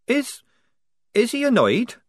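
high, faltering fear; quavering. Acted. Polly nervously asking about the prince, quavering. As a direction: stumble out the question nervously. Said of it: "Polly quavered".

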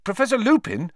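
loud, urgent cut-in; loud interruption. Acted. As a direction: cut in loudly. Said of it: "Harry interrupted loudly".

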